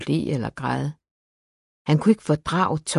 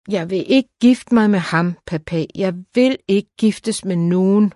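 higher, louder, insistent protest; upset refusal; 4 semitones higher, 4 dB louder than usual. A narrator's reading. Tearful daughter refuses her father's choice of husband, upset protest. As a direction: refuse with rising distress.

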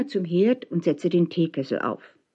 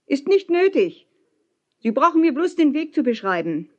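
high, raised refusal; abwehrend gerufen; 8 semitones higher than usual. A narrator's reading. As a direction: call it up, declining firmly. Calling refusal up to ship. (mit hoher Stimme abwehren)

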